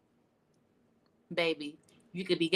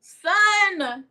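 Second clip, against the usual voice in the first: much higher, louder stretched-out sneer; mocking drawl; 8 semitones higher, 8 dB louder than usual. Real, not acted. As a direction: ridicule the word like a joke. Mocking sunscreen after laugh.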